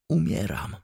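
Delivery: ordinary delivery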